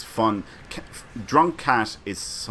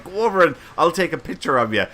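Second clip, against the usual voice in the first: fast, loud eager nudge; eager urging; 7 semitones higher, 6 dB louder, faster than usual. Retold or imitated. Urging a shy friend toward the firemen, excited. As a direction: hurry her over for the photo. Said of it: "I went".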